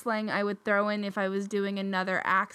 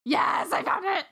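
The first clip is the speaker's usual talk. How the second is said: excited cry of success, higher; 8 semitones higher than usual. Real, not acted. Finding the meme on the phone, real excitement.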